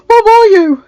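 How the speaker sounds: loud, high, worried cry; anxious cry. A narrator's reading. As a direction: cry out in relief and worry. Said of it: "he cried".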